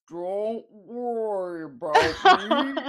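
slow, loud, stiff words forced out of a limp body; mock zombie-like reassurance, very loud and slow; puppeteered body voice. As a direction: speak like a puppet pulled by strings. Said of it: "like a puppeteer".

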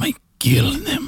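high, slow sinister voice; hammed-up evil line; high, slow mock-sinister confession; mock villain.

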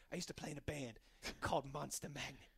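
low, hushed deadpan joke delivery. Retold or imitated. Host retelling a stand-up comedian's joke, deadpan. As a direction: imitate a stand-up's dry, quiet setup. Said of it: "Had a real deadpan delivery".

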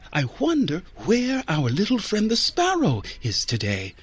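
lightly brighter, chirpy tone; a touch of forced cheer to change the subject; nun steering Joe away, a little bright; brightly. Acted. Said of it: "said the nun brightly".

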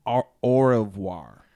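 "au revoir" slow, with a full rolled middle /ʁəvwaʁ/; careful French "au revoir"; correct French pronunciation. Shown as a demonstration. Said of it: "It's pronounced".

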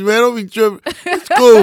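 high, loud comic reassurance; animated calming down. Retold or imitated. Comedian re-enacting calming his crying friend, loud and high. Acting how he calmed her down.

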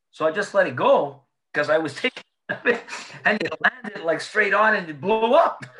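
high and loud, laughing through the words; can't hold it together retelling the excuse. Real, not acted. Telling friends Bergie's wine excuse, laughing high and loud.

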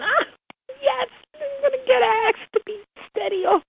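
very high-pitched excited squeal; inner excitement. Retold or imitated. Girl secretly celebrating being asked to go steady, excited squeal. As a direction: squeal it out like a thrilled teenager. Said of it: "But inside I'm going".

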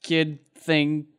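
loud, high angry yell; shouting guard. Acted. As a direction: bark at them to back off. NPC guarding horses, shouting.

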